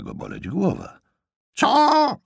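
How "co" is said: very high, loud screech; piercingly high demand to repeat; yelled what. Acted. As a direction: screech it like someone hard of hearing. Half-deaf aunt yells back.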